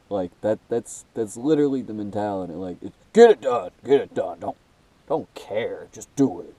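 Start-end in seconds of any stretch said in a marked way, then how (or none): 3.1-6.5 barked mock orders, get it done; hammed-up tough boss voice; macho mindset quote. Voicing the macho mentality.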